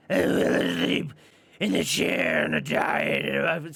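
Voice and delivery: put-on slow, straining old-man rasp, swelling to loud; Bill Wilkins impression. Movie ghost recalling his death, in strained demonic old-man rasp. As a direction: attempt a possessed, croaking demonic growl, coughing between tries. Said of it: "he goes and says".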